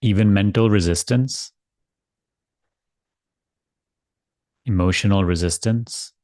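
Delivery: calm spiritual guidance, spacious and gentle; guided meditation. Acted. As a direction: ease them into letting go. Leads guided surrender meditation.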